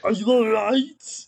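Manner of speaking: put-on measured Austrian-accented concern; Schwarzenegger impression. Action hero checks on partner in a slow Austrian accent. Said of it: "Arnold Schwarzenegger?".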